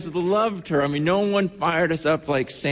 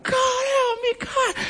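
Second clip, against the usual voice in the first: retold scream; slower than usual. Patient in pain heard from the hospital hallway, imitated screaming. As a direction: imitate an agonized high-pitched cry to God. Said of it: "she's just screaming out".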